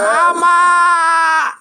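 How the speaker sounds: high mock wail of mama; mock crying scream. Mocking the arrested man, high crying mock scream for mama. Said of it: "You screaming".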